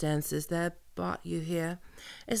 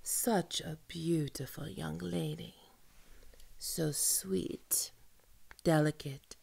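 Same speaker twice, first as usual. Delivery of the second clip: leering whisper; 2 semitones lower, 8 dB quieter, slower than usual. Acted. Leering at captive.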